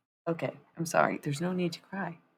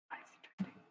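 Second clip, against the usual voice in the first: whispered; 18 dB quieter, much faster than usual. Real, not acted. Repeats question, fading to whisper.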